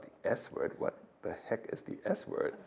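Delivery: whispered inner voice, baffled; hushed puzzled thought. Retold or imitated. Whispering his own baffled thought on overhearing colleagues. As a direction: breathe it to yourself, puzzled.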